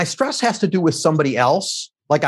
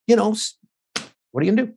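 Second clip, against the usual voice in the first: normal talking voice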